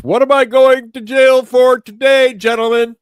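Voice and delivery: high, booming imitation of a commander; imitated commander calling out to his men; loud announcement. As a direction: announce it loudly to the whole motor pool. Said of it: "just loudly announce".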